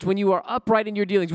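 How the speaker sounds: ordinary talk, nothing marked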